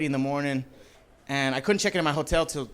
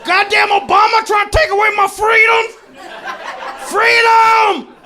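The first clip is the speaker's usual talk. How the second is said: mock angry yelling; 10 dB louder, slower than usual. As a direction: bellow freedom like a mad patriot. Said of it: "yelling freedom for no reason".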